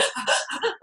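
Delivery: imitated shuddering sob breaths; sobbing gasps. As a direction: gasp like someone crying too hard to talk. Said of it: "your voice just going".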